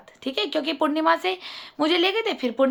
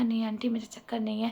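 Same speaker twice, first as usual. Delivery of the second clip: hushed reply; 4 semitones lower, 8 dB quieter than usual. Retold or imitated. Quiet secret reply to aunt.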